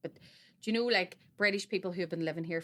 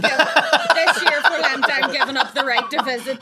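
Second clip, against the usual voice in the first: mock solemn vow; 5 semitones higher, 11 dB louder than usual. Giving up Europe for Lent, loud laughing mock vow. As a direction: announce it like a grand sacrifice, laughing.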